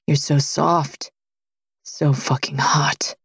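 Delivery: slow, low whispered words to her. Acted. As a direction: breathe it low and slow, almost unvoiced. Lover whispers during intimate scene.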